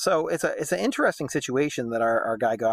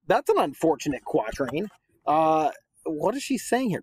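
ordinary delivery

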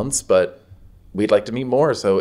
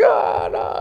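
much higher breathy dismay; mom distressed; 13 semitones higher, 3 dB louder than usual. Retold or imitated. Mom upset after slapping her child. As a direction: gasp it like a guilty mom. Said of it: "She's like".

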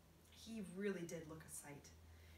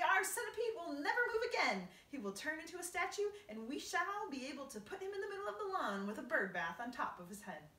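much higher, louder gleeful earthworm; bright earthworm; 9 semitones higher, 8 dB louder than usual. Acted. Earthworm gleefully imagines the centipede as a garden statue. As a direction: paint a cheery picture of the statue.

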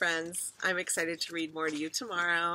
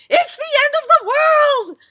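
piercingly high, loud cry; panicked shout; 18 dB louder than usual. A narrator's reading. Mother fears the world is ending, high panicked shout. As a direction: wail it out in full alarm. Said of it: "shouted Missus Watson".

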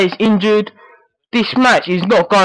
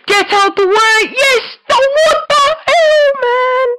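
frantic yelling. Real, not acted. Player yells at game action.

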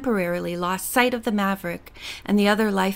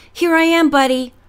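loud, higher cheery greeting with fear under it; half jovial, half scared; 7 semitones higher, 6 dB louder than usual. A narrator's reading. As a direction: bluff cheer covering nerves. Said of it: "in a tone that was half jovial and half scared".

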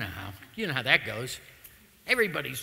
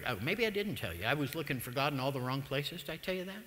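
ordinary talk, nothing marked

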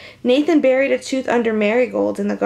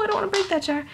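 plain reading voice

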